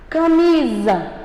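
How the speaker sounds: "Camisa" bem devagar, com força no "mi"; sílaba tônica destacada. Shown as a demonstration. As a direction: pronuncie "Camisa" esticado, acentuando o "mi". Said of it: "Olha como o som ficou mais forte". ("Camisa" devagar, sílaba "mi" mais forte)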